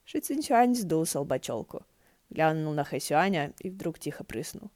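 usual speaking voice

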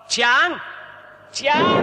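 searching for Chiang in the cave; calling out. Acted. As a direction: call into the dark cave.

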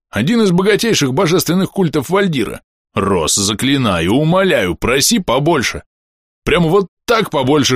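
lively, insistent coaxing that builds; Bom, fervent pleading. Acted. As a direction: urge eagerly, pitch lifted. Voices Bom begging the narrator.

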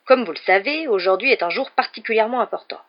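weighty family proclamation, projected; grave announcement. A narrator's reading. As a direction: make a solemn, self-important announcement. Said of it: "s'éclaircit la gorge d'un air grave".

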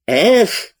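sharp high outraged gasp-cry; a short burst of fury; abbé loses his temper on the stairs; beside himself. Acted. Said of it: "вскричал аббат вне себя".

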